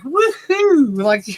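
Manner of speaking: high giddy woohoo; loopy woohoo. Retold or imitated. Retelling the Benadryl high with a high woohoo. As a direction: whoop it out drugged and giddy. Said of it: "laughing all loopy and everything".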